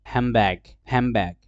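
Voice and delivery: "হ্যামব্যাগ" with m for nd, falling; assimilated form. Shown as a demonstration. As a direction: say handbag as "হ্যামব্যাগ". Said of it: "হ্যান্ডব্যাগকে ওরা বলবে কি".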